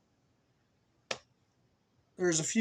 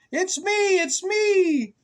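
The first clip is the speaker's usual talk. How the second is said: high, loud playful cow voice; 9 dB louder, much slower than usual. Acted. Cow revealing itself to the child, high and loud. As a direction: call it out like a proud cow.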